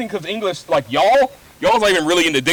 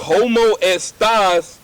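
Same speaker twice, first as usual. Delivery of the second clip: very slow, higher, louder "¿Cómo estás?"; slowed-down Spanish; 7 semitones higher, 5 dB louder, much slower than usual. Retold or imitated. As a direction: spell it out slowly for a native speaker. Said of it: "I hate how you slow it down".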